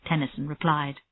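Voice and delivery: plain reading voice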